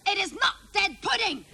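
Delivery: high-pitched mock exasperation; hammed-up fed-up father; exasperated dad imitation. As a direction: insist like a dad at his limit. Retold dad losing patience with children.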